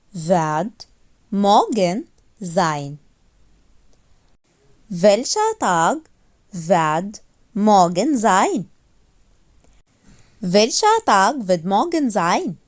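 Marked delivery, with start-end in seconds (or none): none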